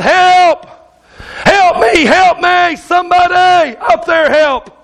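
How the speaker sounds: high, desperate cries for help; mock panicked yelling for help; scream for help. A narrator's reading. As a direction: plead like you're hanging off a cliff. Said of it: "just scream out".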